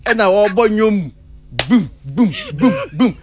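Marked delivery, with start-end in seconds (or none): 1.7-3.2 short, high-pitched "boom" bursts; high mimicked booms, evenly spaced; imitated booming honks. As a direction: punch out four separate booms like a noise effect. Imitates noise in a retold story.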